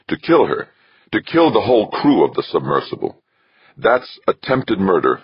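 accusing. A narrator's reading. Archer charging Westfall with attempted murder, accusing. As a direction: build the charge with rising indignation.

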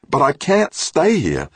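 high, slow, frightened plea; desperate. A narrator's reading. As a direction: beg to get out, near panic. Quarantined woman begging to leave.

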